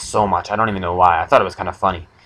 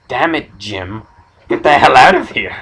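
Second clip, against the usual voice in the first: mock angry outburst, rising at the end; annoyed girlfriend; 4 semitones higher, 5 dB louder than usual. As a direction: play-act kicking him out, louder and higher. Acted girlfriend yelling at boyfriend.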